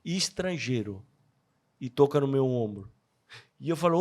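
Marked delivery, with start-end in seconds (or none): 0.0-1.0 slow, soft and low, tenderly repeated; gentle old man. Retold or imitated. Old man naming the foreigner again, slower and softer. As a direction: repeat it softly and slowly, full of kindness. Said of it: "Olha o nome que ele usa".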